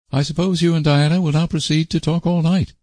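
high, dry sarcastic remark. A narrator's reading. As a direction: say it with a sarcastic bite. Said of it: "said Marilla sarcastically".

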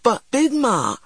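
put-on high, whining child's objection; high-pitched plea, falling away; pleading protest. A narrator's reading. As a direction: protest to your grandmother in disbelief. Child protests order to apologize.

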